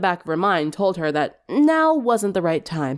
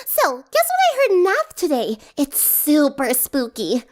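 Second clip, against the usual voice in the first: put-on high, bubbly, excited girl; bubbly Pinkie; 8 semitones higher than usual. A narrator's reading. Pinkie sharing gossip with friends, high and bubbly.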